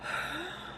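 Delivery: a soft, high, weary sigh; heavy sigh. Real, not acted. Worn out after ranting at viewers, a soft weary sigh. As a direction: let the air out, spent.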